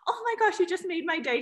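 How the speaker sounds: high, gushing gratitude; thrilled reaction. Retold or imitated. Woman at post office thanking her admirer, thrilled. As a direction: imitate someone overjoyed by a compliment.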